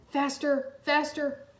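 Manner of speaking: building from urgent to a slow high cry; urgent exclaim. A narrator's reading. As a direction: push harder, then cry out in dismay. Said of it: "Kai exclaimed".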